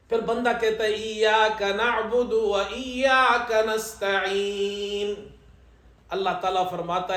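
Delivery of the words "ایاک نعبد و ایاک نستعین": very slow, melodic chanted verse; Quran recitation. Shown as a demonstration. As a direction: draw out each word in tajwid chant.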